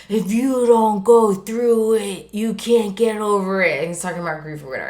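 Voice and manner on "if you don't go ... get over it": slow, drawn-out monster warning; huge monster. Retold or imitated. Grief monster chasing the son, slow menacing warning. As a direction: loom over them, stretching the words. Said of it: "like, this huge monster".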